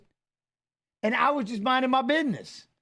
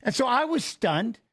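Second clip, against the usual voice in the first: ordinary talk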